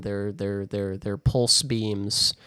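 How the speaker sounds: slow, deliberate correction of the word pulse; spelling it out for the friends, stressing pulse; careful correction. Shown as a demonstration. Insisting to friends on "pulse beams" slowly. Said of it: "it's important".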